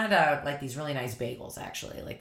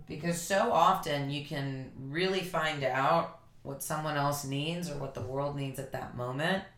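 ordinary delivery

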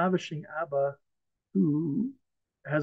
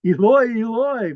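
raised, higher cry to God; acted crying out; 4 semitones higher, 6 dB louder than usual. Preacher voices a sufferer calling to God, crying out. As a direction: cry it out on someone's behalf.

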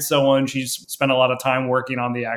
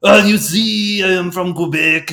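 mock Quebecois accent; 6 semitones higher, 5 dB louder than usual. Mocking separatists with a joke Quebecois accent. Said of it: "doing the Quebecois accent I do".

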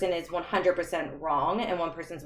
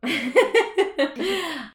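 high, loud burst of laughter; real laugh; 11 semitones higher, 6 dB louder than usual. Real, not acted. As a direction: laugh out at the remark. Host's remark about weakness.

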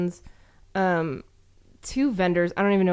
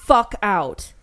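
retold stern order; 5 dB louder than usual. Retold confrontation with the lady.

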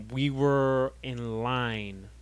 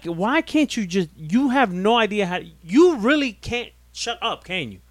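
heated, raised-pitch complaint; exasperated scolding; 7 dB louder than usual. Real, not acted. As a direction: tell off a co-host who won't stop talking. Berates co-host for interrupting.

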